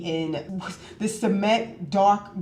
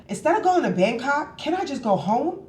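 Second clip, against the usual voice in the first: higher-pitched bargaining question; mock pleading, pitched up; retold plea; 7 semitones higher than usual. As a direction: act out asking to be let off.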